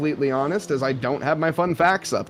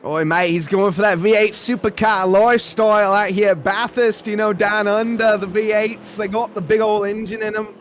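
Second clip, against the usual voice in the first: hammed-up Australian accent, pitched higher; broad Aussie accent, playing the bloke; Wade Georgeson impression; 5 semitones higher than usual. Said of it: "my Wade Georgeson impression".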